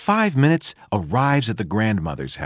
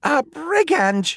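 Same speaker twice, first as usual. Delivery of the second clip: high, slow alarmed shout; outraged cry; slower than usual. A narrator's reading. Grandmother finding the wolf in her house, high cry. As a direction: cry out at the intruder. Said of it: "she cries".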